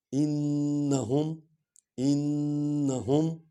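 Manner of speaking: انہم very slow with a held nasal nun; drawn-out ghunna. Shown as a demonstration. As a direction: stretch the doubled nun of انہم. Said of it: "stretched recitation".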